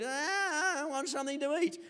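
high, soft mock-child whine; whining child. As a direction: imitate a little one wailing for food. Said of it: "their child said".

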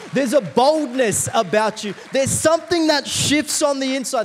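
loud, high sermon shout; rousing pulpit cry, stretched key phrases; full-force preaching. Real, not acted. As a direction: preach it out to the whole congregation.

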